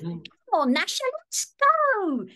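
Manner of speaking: high, loud northern English cartoon boy; northern English Dennis. Shown as a demonstration. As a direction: stretch it out in a northern English accent. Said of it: "wanted Dennis to have a northern accent".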